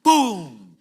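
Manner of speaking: loud punch noise. Retold or imitated. Retelling a punch in a fight.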